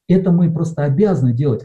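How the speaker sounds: ordinary talk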